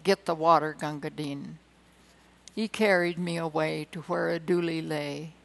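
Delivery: rhythmic ballad recitation with soldier swagger; poem recitation. Acted. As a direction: declaim the barrack-room ballad. Recites Gunga Din like dad.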